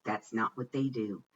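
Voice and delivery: ordinary delivery, nothing marked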